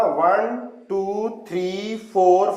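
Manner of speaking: ordinary delivery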